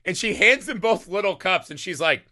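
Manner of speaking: ordinary talk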